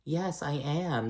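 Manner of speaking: slow, soft, high saintly act; mock innocent. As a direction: play the saint, sweetly fake. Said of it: "all innocent and shit".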